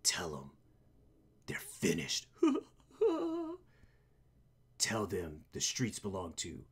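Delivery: low, soft, menacing hero; low vigilante voice. Acted. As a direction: murmur threats like a dark avenger. Voicing the vigilante.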